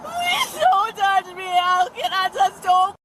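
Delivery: high, slow sobbing pleas. Real, not acted. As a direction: sob out the pleas. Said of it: "sobbing as if the entire".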